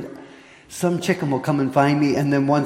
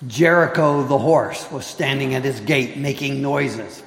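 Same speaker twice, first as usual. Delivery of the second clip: normal talking voice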